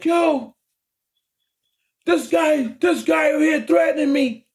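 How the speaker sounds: high-pitched mock cry for help; imitated calling out. Driver complains to security guard, imitated high-pitched call. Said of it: "He calls out the security guard".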